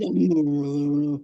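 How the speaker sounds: low, drawn-out wordless mumbling imitation; deep bass rumble. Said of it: "singing deep bass".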